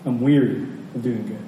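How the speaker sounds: normal talking voice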